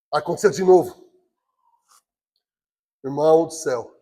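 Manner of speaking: usual speaking voice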